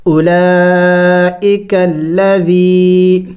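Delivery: اولئک الذی very slow, each madd held long; drawn-out recitation. Shown as a demonstration. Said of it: "تو ہم نے اس کو کھینچنا ہوگا". (اولئک الذی کو لمبا کھینچ کر پڑھنا)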